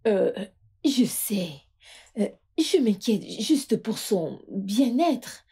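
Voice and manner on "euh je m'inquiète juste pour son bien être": ordinary delivery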